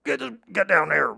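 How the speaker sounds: loud, pushed-up campy voice; cop-show character. Shown as a demonstration. Cop-show guy giving orders, campy character voice. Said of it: "we'll do that voice".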